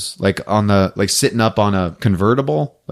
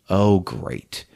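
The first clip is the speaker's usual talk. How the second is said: resigned sigh; 5 semitones lower, 8 dB quieter than usual. Retold or imitated. Retold resigned reaction to flames.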